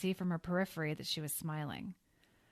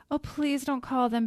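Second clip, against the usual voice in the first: crying out; 8 semitones higher, 7 dB louder than usual. Acted. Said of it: "Betty cried".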